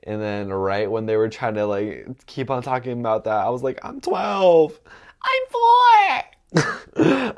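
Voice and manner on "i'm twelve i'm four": mock tiny child, much higher and louder; little kid voice. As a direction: pretend to be a small kid to escape. Claiming to be a child, retold.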